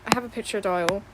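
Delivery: ordinary talk, nothing marked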